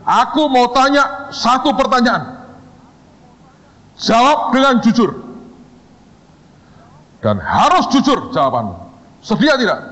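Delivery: lightly acted stern interrogator, high-pitched; mock stern boss. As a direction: raise the pitch in a mock-menacing demand. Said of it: "[name] sengaja pasang muka serem".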